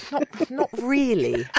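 high, slow, hesitant squirm; reluctant refusal. Retold or imitated. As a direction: draw it out high, wary of saying no. Reluctant reply to director's accent request.

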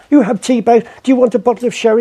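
hammed-up generous shopkeeper offer; eager shopkeeper. Shopkeeper offering sherry, voiced.

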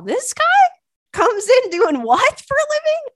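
high, loud squeal of disbelief; incredulous. Real, not acted. Freaked out by a man's odd job, incredulous. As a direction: shoot up high, utterly baffled by it.

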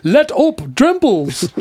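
high, hammed-up Dutch accent. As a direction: shout it in a mock Dutch accent. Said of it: "you got a Dutch accent here?".

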